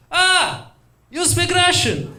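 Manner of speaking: overjoyed Russian hearing his language; hammed-up delighted Russian.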